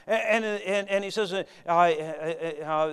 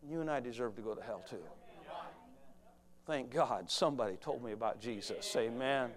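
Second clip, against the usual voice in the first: hushed aside; 6 semitones lower, 8 dB quieter than usual. Real, not acted. Preacher drops to intimate aside.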